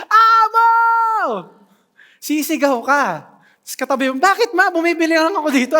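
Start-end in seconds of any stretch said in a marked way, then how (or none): 0.1-1.5 much higher, louder all-out yell; full shout. Shown as a demonstration. Parent screaming for a lost child in a crowded market. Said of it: "Isisigaw mo hanggang maubusan ka ng hininga". 4.2-5.7 put-on higher, louder, fast startled kid reply; a kid surprised mom is yelling; child answering. Child tells frantic mother he's just shopping nearby.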